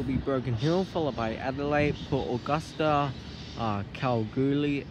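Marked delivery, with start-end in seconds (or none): none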